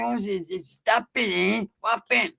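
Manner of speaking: high, slow muffled squeak; squeaky voice. Retold or imitated. Squeaky-voiced homeboy meddling as a would-be gangster, high muffled squeak. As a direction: squeak it out slowly from under a mustache. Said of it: "a squeaky voice".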